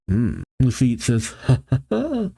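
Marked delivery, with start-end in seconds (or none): 1.4-2.3 high mock chuckle; put-on high villain giggle; acted character laugh. Lafitte's laugh read aloud.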